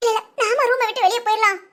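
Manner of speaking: usual speaking voice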